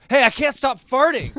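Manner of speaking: loud, high yell across the street. Retold or imitated. Dad yelling at a lady at a stoplight, loud. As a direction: holler it out the car window. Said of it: "I just yelled at her".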